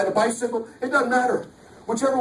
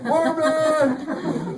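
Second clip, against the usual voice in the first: mock call for dog; 8 semitones higher than usual. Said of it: "standing on the curb going".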